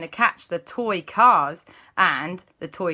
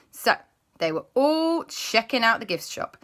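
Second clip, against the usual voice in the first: ordinary delivery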